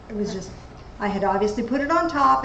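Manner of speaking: ordinary talk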